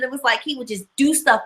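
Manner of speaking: ordinary talk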